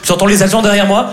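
ordinary talk, nothing marked

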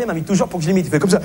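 ordinary talk, nothing marked

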